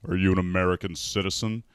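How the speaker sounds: imitating a tough border guard asking about citizenship. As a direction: bark the question like a border agent. Border Patrol guard questions the driver.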